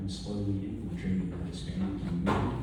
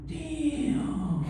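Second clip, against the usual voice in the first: high surprised damn; 13 semitones higher than usual. Retold or imitated. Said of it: "And I'm like".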